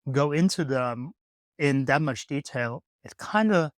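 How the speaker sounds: ordinary talk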